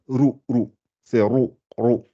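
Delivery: rou with a full, darkened r; heavy rou. Shown as a demonstration. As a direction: swell the r in rou. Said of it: "Faut que je la gonfle".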